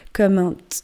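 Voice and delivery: stuck on the first sound, cut short; tongue-tied, breaking off mid-word; searching for the word. A narrator's reading. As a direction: start it and get stuck. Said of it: "il cherchait éperdument le mot".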